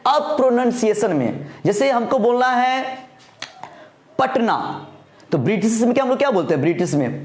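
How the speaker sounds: usual speaking voice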